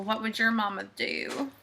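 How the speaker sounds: usual speaking voice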